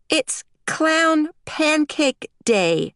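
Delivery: put-on high, slow, deliberate child voice; crisp little girl. A narrator's reading. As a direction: inform Daddy word by word. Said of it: "she informed him crisply".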